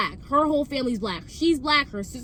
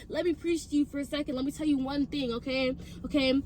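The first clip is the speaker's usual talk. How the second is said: hushed lecture; 7 dB quieter than usual. Retold or imitated. Lowered voice lecturing the friend.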